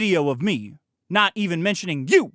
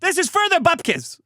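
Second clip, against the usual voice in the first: put-on Yiddish accent; 10 semitones higher than usual. Continues imitated critic voice.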